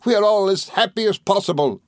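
high, jubilant gentleman; overjoyed, pitched well up; excitable friend. A narrator's reading. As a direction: declare everyone's happiness with glee.

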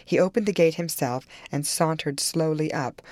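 usual speaking voice

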